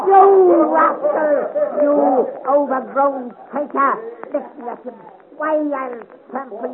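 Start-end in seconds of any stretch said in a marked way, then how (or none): 0.1-3.9 loud, slow, seething insults; furious shout. Acted. Little bombardier shouting insults at the mocking cavalryman. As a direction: explode at the tall soldier.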